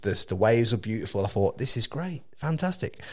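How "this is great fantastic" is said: retold delight. Retold pleased thought before the wipeout.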